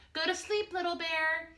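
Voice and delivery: lightly voiced gentle father bear; a hint of a kind papa bear; Big Bear voice. A narrator's reading. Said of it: "he said".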